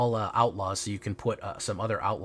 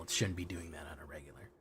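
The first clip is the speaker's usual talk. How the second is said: whispered aside; 5 semitones lower, 12 dB quieter, faster than usual. Real, not acted. Muttering about a game error.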